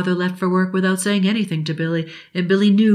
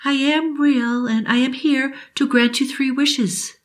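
higher, slow, solemn genie voice; kind old genie; 7 semitones higher than usual. Acted. Genie assuring Billy she is real, slow and higher. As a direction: promise it like a fairy godmother.

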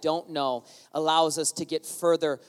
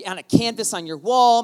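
ordinary talk

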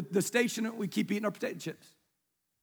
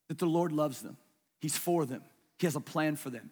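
softly sincere, lower and hushed; tender hushed wish; 8 semitones lower, 5 dB quieter than usual. Retold or imitated. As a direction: drop the voice low and soft, heartfelt. Heartfelt wish voiced as the congregation.

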